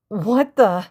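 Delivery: high, loud queasy whine; whining. A narrator's reading. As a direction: whine it in complaint. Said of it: "she whined".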